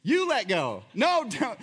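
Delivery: cling on and refuse in playful alarm; panicked refusal. Retold or imitated. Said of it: "It's like".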